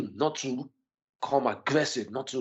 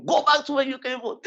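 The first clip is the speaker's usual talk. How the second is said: much higher mock voice of a hostile woman; imitating a hostile woman; 13 semitones higher than usual. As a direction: throw it out high like the stranger did. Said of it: "this is a woman coming up to you".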